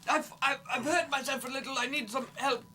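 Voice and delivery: high, strained call for help, hammed up; screaming for help. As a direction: play hurt and plead up from the well. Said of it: "I like the screaming more".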